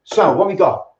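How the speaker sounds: normal talking voice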